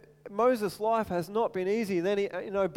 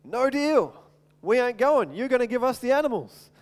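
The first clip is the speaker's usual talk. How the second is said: louder and higher, defiant no deal; bold refusal; 4 semitones higher, 5 dB louder than usual. Retold or imitated. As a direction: stand your ground against the king. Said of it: "What does Moses say?".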